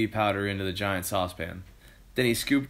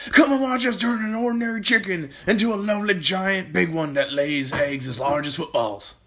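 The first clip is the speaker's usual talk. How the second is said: high, loud boast, easing towards the end; excited calling out; 9 semitones higher, 5 dB louder than usual. A narrator's reading. Mister Kilcranky calling his wife to see the chicken, excited shout. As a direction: holler it like a proud showman. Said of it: "he called out to Missus Cranky".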